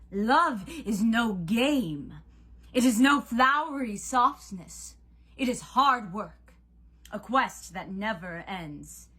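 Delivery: loud, slow, thundering lecture; stern god Cupid. A narrator's reading. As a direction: declaim it forcefully, stretching each key word.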